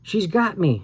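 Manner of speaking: high, terrified scream. A narrator's reading. Al screams to Sam while grabbed: high terrified scream. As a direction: scream as hands seize you. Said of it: "I screamed".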